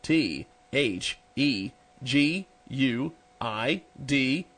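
very slow, letter by letter spelling; spelled out slowly. Shown as a demonstration. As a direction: spell each letter out carefully. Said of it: "And we'll spell it for you".

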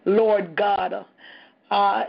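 ordinary delivery

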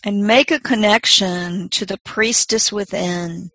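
slow, soft meditation voice; guided meditation. Acted. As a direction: gently guide them inward, unhurried.

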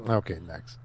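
low, quiet dismissal; dismissive. Retold or imitated. Retelling own reaction to porn, quietly dismissive. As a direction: drop it low and soft, moving on. Shrugging off a turn-off.

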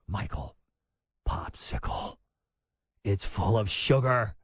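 low, unvoiced scornful hiss of the word popsicle; mock disapproving parent. Host mocking a wellness parent, hissed and scornful. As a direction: sneer it under your breath like a horrified parent.

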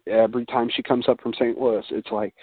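ordinary delivery

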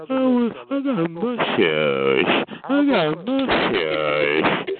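very slow, dopey mock brag; slow, dim-witted mocking drawl; mocking his voice. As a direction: drag each word like a dim show-off. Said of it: "You sound like".

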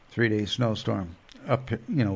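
ordinary talk, nothing marked

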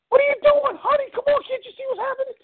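very high falsetto protest; frantic shrieking wife. Acted. Wife protests as husband walks back to car, shrieking. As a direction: shriek at him as the panicked wife.

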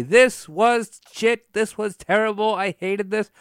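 exaggerated pretend outrage, loud and high; mock rant. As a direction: pretend to trash the show with big indignation. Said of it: "how bad I wanted to come on here this evening and say".

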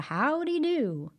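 high, slow folksy hello; friendly greeting. Acted. Brer Rabbit greeting folks on the road, cheery high call. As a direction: tip your hat to a passerby.

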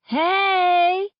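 high, loud, long-held "hey"; party whoop. A narrator's reading. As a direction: holler it out like a favorite song came on. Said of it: "She and Aunt Pam both go".

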